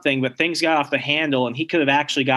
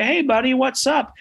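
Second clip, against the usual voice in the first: cheery fake dad; 10 semitones higher than usual. Possessed dad fakes cheerful greeting.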